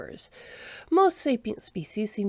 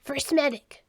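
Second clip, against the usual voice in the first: a higher call across the room; 7 semitones higher than usual. Acted. Second Sister summoning the medic over the comm unit, calling out. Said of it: "she called".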